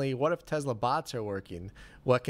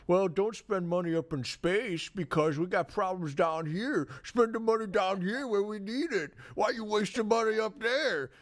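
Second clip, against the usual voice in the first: high, whiny mocking imitation; 8 semitones higher than usual. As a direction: mimic a whiny complainer.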